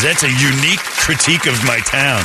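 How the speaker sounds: a touch of a dry, amused father; fan's dad. Retold or imitated. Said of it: "He says".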